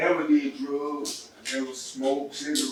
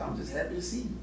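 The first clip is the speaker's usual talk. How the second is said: softer, higher imitation of a crying mother; mildly tearful, quietly insisting; retold tearful reassurance; 4 semitones higher, 7 dB quieter, faster than usual. Mom answering why she is crying, lightly imitated tearful reassurance. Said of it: "she just started crying".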